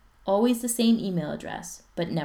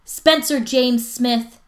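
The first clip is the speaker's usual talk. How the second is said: louder, higher, slow warning yell; stern full-name yell; mom yelling full name; 7 semitones higher, 11 dB louder, much slower than usual. Acted. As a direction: bellow each name slowly. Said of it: "his mom yells".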